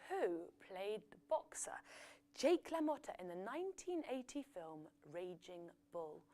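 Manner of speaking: breathy whisper, drawn in close; whispered question. Acted.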